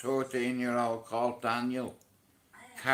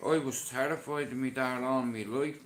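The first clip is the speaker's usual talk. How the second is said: usual speaking voice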